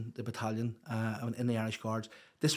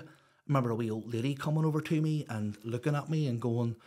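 usual speaking voice

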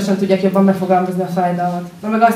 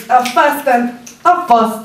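"Fast" punched louder and higher, the title cut shorter; building punning emphasis; 4 semitones higher, 4 dB louder, much slower than usual. Acted. Slammer whittling the title down to a pun, emphatic "Fast". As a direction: hit "Fast" harder as the title shrinks.

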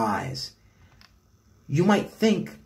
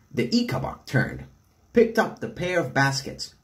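usual speaking voice

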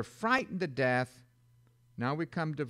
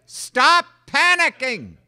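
loud, high, slow Stop panicking; shouted command; 10 semitones higher, 13 dB louder, slower than usual. Shown as a demonstration. Preacher shouting back at a panicked believer. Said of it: "They're always panicking".

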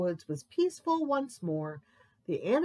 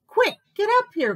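high, loud, fast command; hurried high-pitched shout; urgent mouse order; 13 semitones higher, 8 dB louder, faster than usual. A narrator's reading. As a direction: rush it out urgently. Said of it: "growled Mouse".

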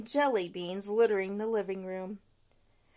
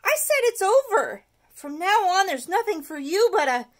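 much higher, louder; angry cry; 9 semitones higher, 10 dB louder than usual. Acted. Stuart yelling at his tooth. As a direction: yell at the tooth.

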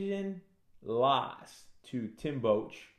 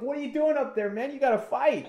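high, loud incredulous referee voice; referee scolding; 12 semitones higher, 4 dB louder than usual. Retold or imitated. Referee Herb Dean scolding the fighter, loud. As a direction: order him down like a ref. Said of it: "Herb Dean's like".

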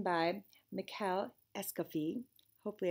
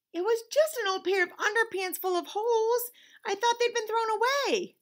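much higher pleading whine; whiny excuse; 12 semitones higher, 3 dB louder than usual. A narrator's reading. Leon excusing himself to his conscience, high whine. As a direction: plead your innocence.

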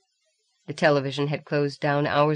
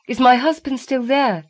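raised, pleading plea to the phone; 8 semitones higher, 5 dB louder than usual. A narrator's reading. Allison pleading with the woman on the phone. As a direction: beg for news, voice lifted. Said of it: "Allison pleaded".

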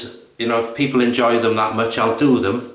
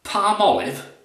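much higher, slow, appalled posh snob; shaving snob; 11 semitones higher than usual. Retold or imitated. Snob recoiling at cheap shaving soap, hammed-up disgust. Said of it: "the shaving snob".

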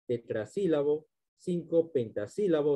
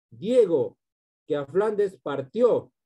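slow, raised declamation; verse recitation; 3 semitones higher, 5 dB louder, slower than usual. Shown as a demonstration. Teacher reciting the poem's last line to students, declaimed.

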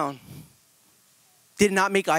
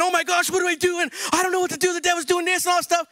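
mock panicking leader; 9 semitones higher, faster than usual. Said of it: "all scared and panicking".

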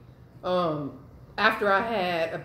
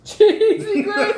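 laughing hard, much higher and louder; 11 semitones higher, 10 dB louder, much slower than usual. Real, not acted. Teasing her sister's old mac and cheese, laughing. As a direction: crack up mid-word.

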